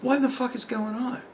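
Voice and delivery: baffled question under your breath; hushed bafflement. Retold or imitated. Worker reacting to office staff on the line, hushed bafflement.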